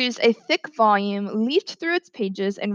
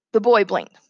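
ordinary delivery

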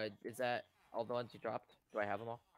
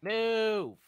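much higher, louder frustrated yell; scared and angry; 12 semitones higher, 13 dB louder than usual. Real, not acted. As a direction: snap it at the crowd. Villagers blocking, golem attacking.

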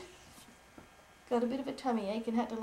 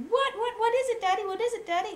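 much higher, louder, breathless begging; eager children; 12 semitones higher, 7 dB louder than usual. Acted. Children begging daddy to reveal it, excited. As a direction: pester like an eager kid. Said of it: "the children said".

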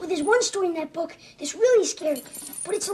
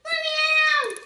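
frightened shout; 11 semitones higher, much slower than usual. Acted. Trapped boy screaming to be let out, terrified.

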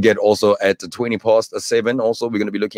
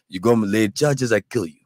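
Russian guy. Acted. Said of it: "the Russian guys were like".

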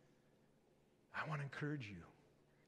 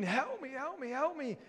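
high mock cry for help, "help" pitched up; playing the drowning woman crying out; retold cry for help; 10 semitones higher than usual. Preacher imitating her frantic call for help, pitched high. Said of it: "and I was screaming".

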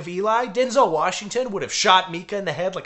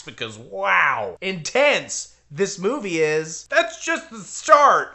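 slow mock-intense sarcasm; drawn-out sarcastic awe; slower than usual.

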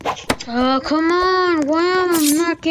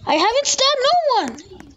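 mock innocence; 9 semitones higher than usual. Denying a stab to buddy.

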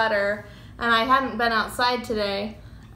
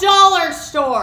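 excited; 6 semitones higher, 9 dB louder than usual. Real, not acted. Stressing cheap dollar-store source.

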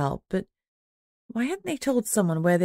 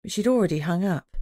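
usual speaking voice

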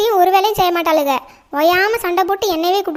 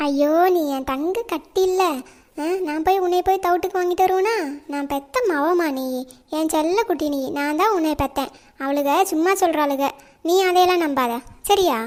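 tender comforting; 4 dB quieter than usual. Acted. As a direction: gently console a crying little girl. Mother comforts crying daughter.

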